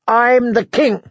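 put-on a spoiled boy pulling rank; indignant boy king. A narrator's reading. Joffrey asserting his rank.

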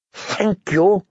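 strangled, incredulous question; choked voice. A narrator's reading. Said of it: "Tyrion's voice was choked".

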